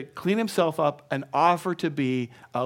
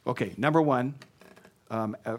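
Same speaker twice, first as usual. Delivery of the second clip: usual speaking voice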